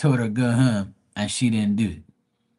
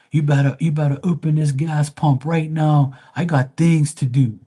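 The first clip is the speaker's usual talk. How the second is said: pushy mock customer, louder, higher; demanding customer; 5 semitones higher, 5 dB louder than usual. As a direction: bark orders like an impatient customer. Customer demanding the pump open.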